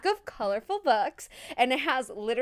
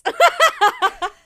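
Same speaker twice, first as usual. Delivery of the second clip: high, loud burst of laughter; 9 semitones higher, 11 dB louder than usual. Real, not acted. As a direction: burst out laughing at your own joke. Laughs after joking about receiving gifts.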